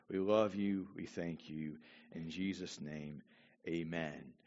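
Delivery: prayer. Real, not acted. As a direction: pray it reverently. Leads a prayer.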